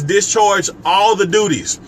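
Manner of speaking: slow, with all leaned on hard and drawn out; stress on all. Shown as a demonstration. Reading scripture to live viewers, stressing "all" slowly. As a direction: hit the word "all" so no duty is left out. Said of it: "Let me put emphasis on all".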